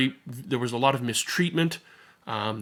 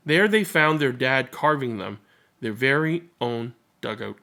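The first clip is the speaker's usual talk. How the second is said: gentle read-aloud storytelling voice; story narration; 3 semitones higher than usual. A narrator's reading. Narrator reading a picture book to listeners, gentle storytelling.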